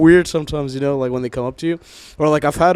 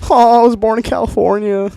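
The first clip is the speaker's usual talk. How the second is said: put-on higher Valley-style Californian accent; 8 semitones higher, 4 dB louder than usual. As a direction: lay the Californian accent on thick. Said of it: "up my Californian accent".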